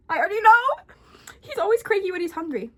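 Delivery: high, loud amused outburst; excited exclamation. Real, not acted. Reader reacts to the cat twist, loud and excited. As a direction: blurt it out, delighted.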